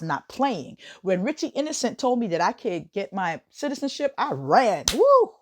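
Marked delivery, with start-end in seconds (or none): 4.9-5.3 high, loud celebratory whoop; excited whoop. Real, not acted. Recalling running to get citizenship, a loud joyful whoop. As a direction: whoop it out with joy.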